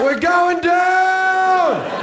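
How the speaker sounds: high, drawn-out panicked yell. Retold or imitated. Terrified comedian yells to the soldiers as they fall. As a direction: scream it like the chopper is falling. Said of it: "And yelling out".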